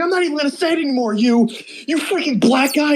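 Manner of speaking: put-on high, fast indignant kid voice; meme kid voice. Retelling a meme where a kid refuses to say it, high and fast. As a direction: rattle it off like an outraged kid. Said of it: "He's like".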